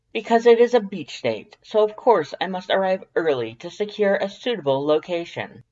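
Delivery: high, loud, eager skeleton; eager proud explanation. Acted. Papyrus explaining to Dance why he leaves early, high and loud. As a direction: announce the plan eagerly.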